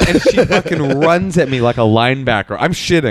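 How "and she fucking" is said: ordinary delivery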